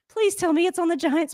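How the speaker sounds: high, desperate plea at the TV; high pleading at the screen. Real, not acted. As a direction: beg it, voice way up. Begging ref about flag.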